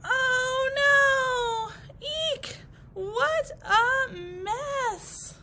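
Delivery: high, loud wailing cry; dismayed shriek. Acted. Cut grasses seeing the mess, high wailing shriek. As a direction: shriek over the ruined lawn.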